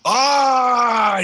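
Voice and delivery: loud high hearty exclamation; hearty dwarf cry; jolly dwarf. Acted. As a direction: burst out as you go for the hug. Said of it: "big dwarven hug".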